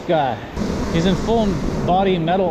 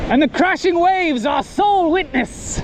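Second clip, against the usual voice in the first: knight shout; 9 semitones higher than usual. Pretend. Knight proclaims loudly.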